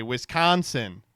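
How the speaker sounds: put-on "Wisconsin" stretched slowly, accent barely there; failed local pronunciation. Host mocking the politician's accent attempt, "Wisconsin" slow but unaccented. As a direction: draw out "Wisconsin" loudly with an ordinary "con". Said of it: "or as you all say".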